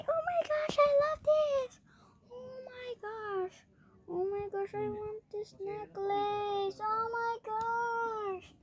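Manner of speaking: high, drawn-out squeal of delight; playful, over-the-top swooning; gushing delight. Pretend. Gushing over a necklace while playing.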